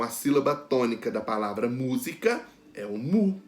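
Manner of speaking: ordinary talk, nothing marked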